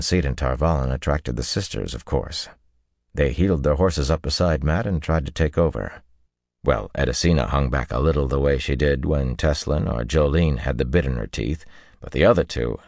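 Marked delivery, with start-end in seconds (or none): none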